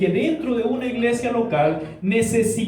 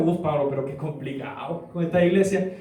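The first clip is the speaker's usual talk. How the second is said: put-on a lower, softer sigh of a fed-up young man; young man fed up with every church, lower and softer; exasperated young man; 6 semitones lower, 4 dB quieter than usual. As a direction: groan it out like a tired churchgoer. Young man complains to Paul.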